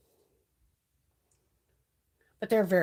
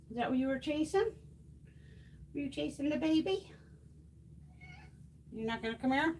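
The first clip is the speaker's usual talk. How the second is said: cooing pet talk; 8 semitones higher, 3 dB quieter than usual. Real, not acted. Cooing at her beautiful cat, high doting pet voice.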